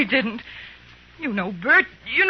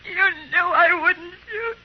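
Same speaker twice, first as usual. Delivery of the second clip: slow, tearful words through sobs; crying; 3 semitones higher, slower than usual. Acted. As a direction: let the voice break, slowly. Jeannie breaks down after gun.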